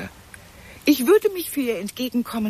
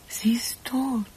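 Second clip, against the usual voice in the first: breathy whisper of shock; hushed stunned whisper; 6 dB quieter, slower than usual. Acted. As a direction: whisper it as if it can't be true. Whispered realisation.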